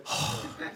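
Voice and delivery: a short, breathy huff of annoyance; imitated huff. Imitating the late father's huff at his wife. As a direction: puff out air like a grumpy husband. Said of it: "and just huff".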